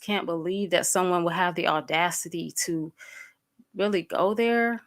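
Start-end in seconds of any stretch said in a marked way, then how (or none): none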